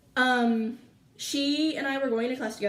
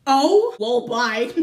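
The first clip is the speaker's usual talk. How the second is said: loud send-off; 9 dB louder, much slower than usual. Retold or imitated. Student leaving class for the office, loud send-off. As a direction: call it out loudly on the way out.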